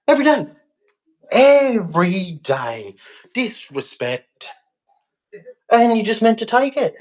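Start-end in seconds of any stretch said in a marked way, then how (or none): 0.1-0.5 emphatic outburst. Real, not acted. Repeats Every day, second take slow. 1.3-4.5 Every day dragged out slowly; slow heavy repeat. Real, not acted. Repeats Every day, this take louder.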